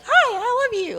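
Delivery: sudden very high, bright welcome; gushing greeting. Retold or imitated. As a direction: squeal it like an overjoyed aunt. Doris greeting her warmly.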